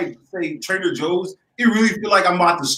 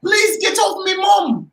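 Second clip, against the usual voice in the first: mock teen plea; 11 semitones higher than usual. Mock teen pleading at airport.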